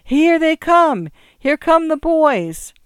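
high, loud, drawn-out crowd cheer; dramatic triumphant shout; exultant cry. A narrator's reading. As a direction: call it out exultantly, stretching the words. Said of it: "exultant note in its voice".

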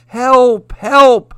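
loud high cry for help. A narrator's reading. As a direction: shout for help in panic. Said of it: "Moose heard a cry".

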